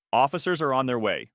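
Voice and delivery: ordinary delivery, nothing marked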